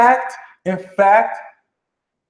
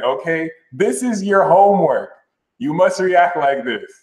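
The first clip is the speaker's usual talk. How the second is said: ordinary talk, nothing marked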